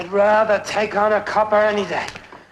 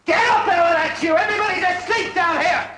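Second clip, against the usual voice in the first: high, raised yell; rousing shout; 7 semitones higher than usual. Acted. Man yelling to wake sleepers, rousing shout. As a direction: call out to wake everyone.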